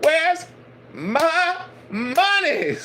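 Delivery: hammed-up pimp demanding cash. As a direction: pastor mocking the master as a pimp, high and laughing. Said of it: "he like".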